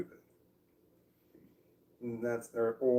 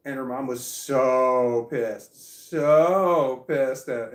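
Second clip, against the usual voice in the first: slow, loud, drawn-out so pissed; emphatic outrage; 9 dB louder, much slower than usual. Real, not acted. Telling listeners how angry the mom got, slow and loud. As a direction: lean hard into how pissed she was.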